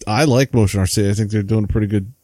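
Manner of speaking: ordinary talk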